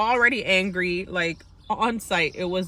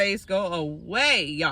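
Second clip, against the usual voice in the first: ordinary talk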